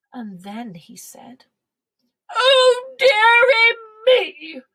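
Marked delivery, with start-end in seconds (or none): 2.3-4.6 high, loud, slow mock sobbing; sobbing king. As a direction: wail it like a sulky king. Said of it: "The king sobbed".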